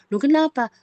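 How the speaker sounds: a mock scolding question, pitched far up; angry doctor. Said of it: "Lu marah dokternya". (dokter marah, nada jauh lebih tinggi)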